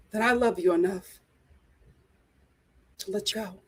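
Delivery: a lightly voiced heartfelt goodbye; mock tender Thomas. Thomas softly telling Becca he will let her go.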